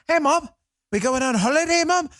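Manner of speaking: put-on high British-accented kid; mock British accent. Kid asking mom about holiday, high mock British accent. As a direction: imitate a child putting on British English. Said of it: "now speaking in British accents".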